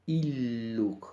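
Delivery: Illuc with both L sounds held apart; both Ls sounded. Shown as a demonstration. Teacher showing the double L, careful. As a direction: say Illuc with a double L, each one heard. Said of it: "cada letra se pronuncia".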